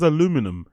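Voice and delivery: aluminum as "uh-LOO-mi-num", in disbelief; American pronunciation. Retold or imitated. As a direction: query aluminum with the stress on "LOO". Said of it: "when Americans pronounce aluminium".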